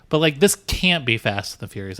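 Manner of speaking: ordinary talk, nothing marked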